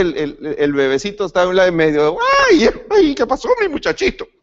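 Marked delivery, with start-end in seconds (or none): 2.5-4.3 put-on high, fond baby talk to a newborn; cooing parent. Parent soothing a crying newborn at night, high cooing baby talk. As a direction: coo over the baby like a doting parent.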